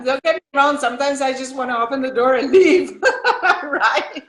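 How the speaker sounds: loud, laughing confession. Real, not acted. Mom laughing as she admits wanting to leave, loudly laughing.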